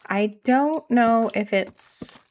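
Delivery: drawn-out nasal "don't" with a Canadian lilt; slow Canadian accent. Shown as a demonstration. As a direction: stretch "I don't know" slowly in a Canadian accent. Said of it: "I talk funny".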